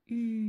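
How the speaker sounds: very long, loud "Ur", u gliding into sustained r; syllabe liée. Shown as a demonstration. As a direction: stretch "Ur": long French u, then a long throaty r. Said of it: "Je ne m'arrête pas". (« Ur » très étiré et fort, u puis r tenu)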